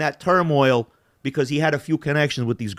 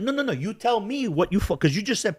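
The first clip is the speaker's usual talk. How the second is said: high-pitched, urgent interruption; retold indignant insistence; 8 semitones higher than usual. Son pressing his mother about the rumour, indignant insistence. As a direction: cut in and demand answers.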